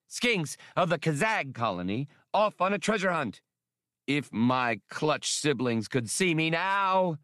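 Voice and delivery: high, thrilled alien voice, slowing at the end; bubbling over with glee; excited alien. A narrator's reading.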